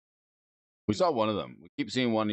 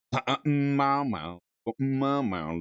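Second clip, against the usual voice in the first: mock meowing; 4 semitones higher than usual.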